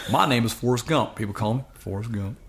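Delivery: joking Southern film-character impression; comic impression of a Southern character; Forrest Gump. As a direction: slip into a simple Southern movie voice. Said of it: "I sound more like Forrest Gump".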